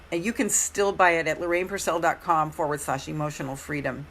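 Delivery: ordinary delivery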